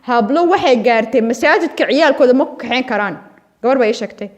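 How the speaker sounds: ordinary talk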